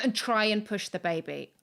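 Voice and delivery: ordinary talk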